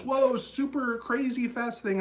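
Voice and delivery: high slow whoa of pretend awe; mock amazement. Teacher mimicking a listener amazed by the speed. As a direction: act dazzled by how fast it sounds.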